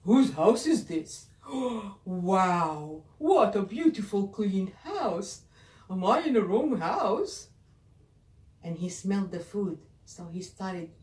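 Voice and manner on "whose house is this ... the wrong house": loud, amazed beast voice. Acted. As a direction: boom it out as the surprised beast. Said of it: "He opened the door and said".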